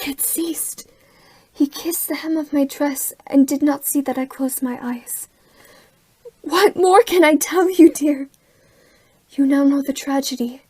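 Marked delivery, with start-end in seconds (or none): 0.0-5.3 soft voiceless whisper; whispered confession. Acted. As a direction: whisper it as a secret shame. Intimate confession of deceit.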